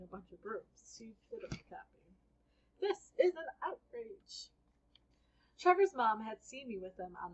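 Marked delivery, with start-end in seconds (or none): 2.8-4.5 high, slow, indignant cry; indignant outrage. Acted. Trevor's mom complaining to Mom, high and slow outrage. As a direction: let the outrage swell.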